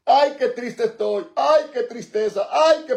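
high, whiny self-pity, each line opening on a moaning ay; mock a sorry believer moaning about their troubles; mock whining complaint. Preacher mocking a complaining believer, high whiny moan. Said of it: "¿Por qué usted va a decir".

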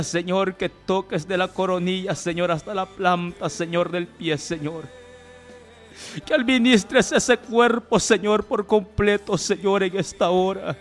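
usual speaking voice

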